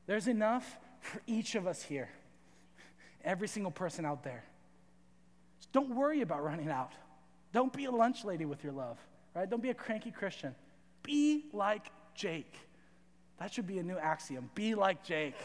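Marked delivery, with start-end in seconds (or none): none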